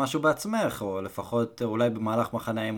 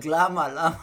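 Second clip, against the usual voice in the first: higher, mock yell breaking into laughter; retold yell; 4 semitones higher, 3 dB louder, slower than usual. Man recalls yelling at fighter from the crowd, laughing mock yell. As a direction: imitate yourself yelling, half laughing. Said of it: "אני צועק".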